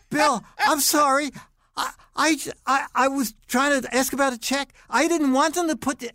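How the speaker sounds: high-pitched, stammering mock apology; squeaky, tripping-over-words retold pleading; flustered apology. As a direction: play your own panicked self apologizing to the boss. Acts out nervous apology to boss.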